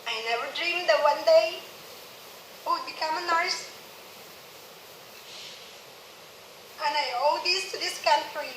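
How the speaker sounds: fighting back tears; crying. Real, not acted. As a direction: voice rising as tears come. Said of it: "I'm crying again".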